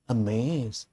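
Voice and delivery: soft gasp, then a hushed amazed. Shown as a demonstration. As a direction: gasp and breathe the word out in wonder. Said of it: "The country mouse is amazed".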